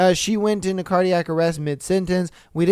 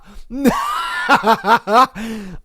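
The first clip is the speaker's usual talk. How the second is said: loud shocked laugh; 6 semitones higher, 4 dB louder than usual. Real, not acted. Reacts to poisoning twist.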